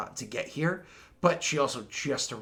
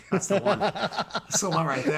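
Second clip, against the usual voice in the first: slow, laughing delight; 5 semitones higher than usual. Retold or imitated. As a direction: chuckle through it, smitten. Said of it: "I was like".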